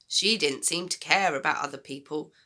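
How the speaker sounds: usual speaking voice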